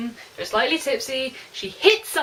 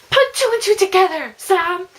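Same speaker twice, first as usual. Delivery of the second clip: high, loud frustrated shout at a character; exasperated yell; 8 semitones higher, 8 dB louder than usual. Real, not acted. Reader yelling at the book character.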